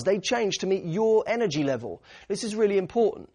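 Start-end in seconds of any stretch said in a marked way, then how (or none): none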